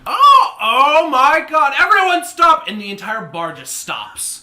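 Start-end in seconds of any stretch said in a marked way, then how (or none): 0.1-2.6 high, loud call to halt; astonished shout. Acted. Bartender stopping the brawl for the bar to hear, astonished shout. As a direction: shout to stop the whole room.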